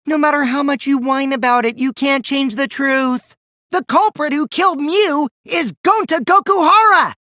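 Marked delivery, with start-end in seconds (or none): 3.7-7.1 high, loud declaration; shouting the culprit's name in triumph; triumphant shout. Acted. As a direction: announce it to the whole room.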